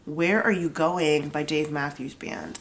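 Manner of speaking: ordinary talk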